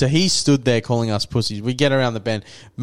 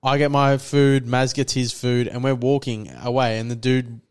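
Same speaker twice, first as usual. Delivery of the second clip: ordinary talk, nothing marked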